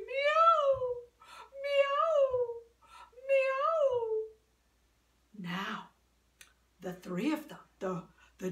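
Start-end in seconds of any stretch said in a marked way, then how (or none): 0.1-4.2 high, loud yowling meows; cat meowing. Acted.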